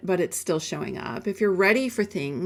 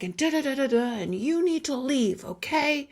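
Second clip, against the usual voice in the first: high-pitched imitation of a scolding husband; mock angry scolding; 6 semitones higher than usual. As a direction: imitate his tirade without really yelling. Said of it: "angry and screaming at me".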